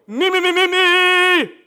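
loud, high, snarling selfish monster. Acted. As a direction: roar it like the self-monster clawing back. Monster of self fights back.

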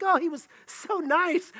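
high-pitched gushing imitation; mock wistful. Woman misremembering her old boyfriend, mock wistful. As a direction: swoon mockingly over an old flame. Mimics rosy memory of abusive boyfriend.

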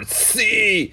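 very high-pitched holler; high, stretched shout of agreement; imitated cheering yell. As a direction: cheer it out like a rowdy neighbor. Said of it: "they laughed and yelled".

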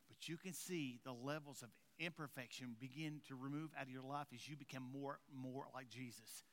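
low, soft, weary admission; hushed, confiding preaching. Real, not acted. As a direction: drop right down and level with the congregation. Hushed confiding preaching after climax.

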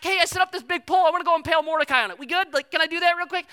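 high, loud, fast eager asking; eager Haman. Retold or imitated. Haman eagerly about to ask the king, high and fast. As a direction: rush the request all excited.

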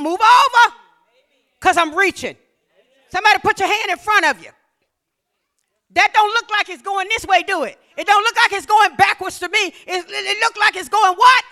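rousing call-and-response preaching; full-force preaching. Acted. Preacher stirring the congregation, rousing and loud. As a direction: lift the crowd with every kingdom word.

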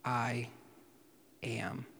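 I am soft and falling, almost a breath; reverent, very soft; hushed I am. Shown as a demonstration. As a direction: let the name of God fall quietly. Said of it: "direct identification with God".

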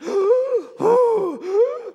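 loud, high mock gasps; imitated passenger gasps. Preacher imitating people on a dropping plane, loud high gasps. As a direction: gasp like startled passengers. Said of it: "you could just hear people going".

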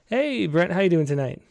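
ordinary talk, nothing marked

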